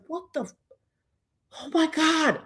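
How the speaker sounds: high, hushed shock; breaking off in astonishment, pitched high; soft, high-pitched gasp of disbelief; stunned disbelief. Retold or imitated. Stunned at seeing her leave.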